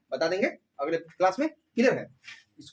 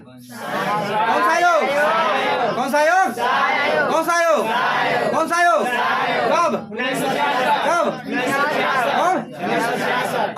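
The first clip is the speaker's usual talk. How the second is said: loud, higher quiz calls to the class; drill shout; 4 semitones higher, 7 dB louder, much slower than usual. Real, not acted. Teacher quizzing the whole class, shouting loud. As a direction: bark the question to wake the class up.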